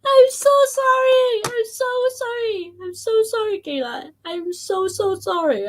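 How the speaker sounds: very high squealed apology, easing down and softening; helpless giggly apology. Real, not acted. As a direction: apologise through the tail of a laughing fit. Apologising straight after a laughing fit.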